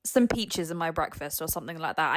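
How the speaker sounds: ordinary delivery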